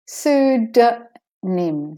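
"Pseudonym" very slow, SOO, duh, nim; de-stressed O. Shown as a demonstration. Teacher isolating the weak vowel, slow "Pseudonym". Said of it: "O is de-stressed".

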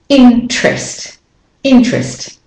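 "Interest" as two syllables, "in-trest"; dropped syllable. Shown as a demonstration. Teacher modelling the omitted syllable, crisp two-beat word. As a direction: leave the "er" out of "Interest". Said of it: "You leave out the er".

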